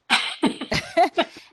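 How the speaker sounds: high unvoiced laugh; airy burst of laughter; breathy laugh. Real, not acted. Photographer pleased with her pitch, airy laugh.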